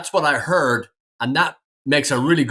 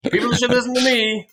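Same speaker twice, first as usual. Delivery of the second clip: higher, sing-out plug for oneself; mock self-promotion; 9 semitones higher than usual. Real, not acted. Co-host jokes that folks should heed them, mock self-promotion. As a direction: chime in with a jokey plea.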